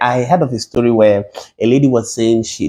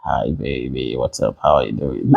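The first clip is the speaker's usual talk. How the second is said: much lower, softer put-on deep voice; fake deep voice; 12 semitones lower, 5 dB quieter than usual. Host tries a fake deep voice on a lady, low and soft. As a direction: drop the pitch way down to sound seductive. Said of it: "can I try something".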